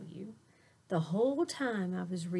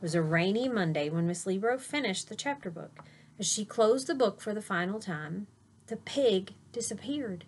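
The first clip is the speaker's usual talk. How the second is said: lively storybook read-aloud; reading to children. A narrator's reading. Teacher reading a picture book to her class, animated. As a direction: read it bright and animated for little listeners.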